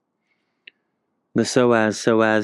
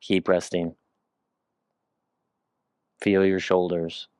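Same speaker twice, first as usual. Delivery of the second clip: robot voice; 3 semitones lower, 4 dB quieter than usual. Real, not acted. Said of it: "I sound like a robot".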